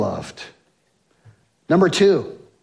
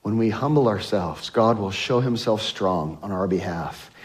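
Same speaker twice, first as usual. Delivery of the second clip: quiet restatement; 8 semitones lower, 6 dB quieter than usual. Real, not acted. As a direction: softly repeat the line, much softer and lower. Quiet restatement after congregation.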